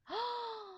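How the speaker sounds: sharp high gasp of shock. Real, not acted. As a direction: gasp at the bad news. Shocked by news in game.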